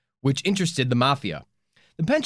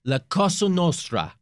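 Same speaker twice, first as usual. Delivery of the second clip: put-on hammy Italian mafia accent; Italian mobster accent; 4 semitones higher than usual. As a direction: play a cartoon Italian mobster. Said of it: "very Italian".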